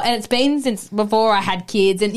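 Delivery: ordinary talk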